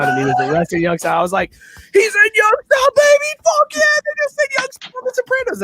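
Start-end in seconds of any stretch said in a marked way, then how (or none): 1.9-5.6 much higher, loud yelling cheer; excited cheering. Retold or imitated. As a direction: whoop it out like your team just scored. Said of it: "I got all excited about it".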